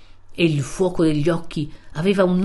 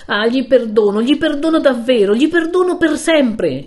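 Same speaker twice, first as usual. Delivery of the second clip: much higher and louder, building with each repeat; fervent exclamation; 8 semitones higher, 7 dB louder than usual. Acted. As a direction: cry out forgiveness desperately. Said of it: "esclamò il giovine". (esclamazione accorata, sempre più alta)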